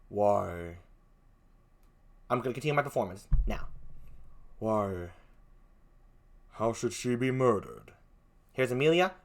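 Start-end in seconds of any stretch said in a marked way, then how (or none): 0.1-0.8 very low, soft character voice; Othello. Acted. Othello responds after Desdemona dies, low and grave. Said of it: "Here's Othello". 4.6-7.9 very low, hushed character voice; Othello. Acted. Othello asks about the murder, low and grave. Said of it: "Here's Othello".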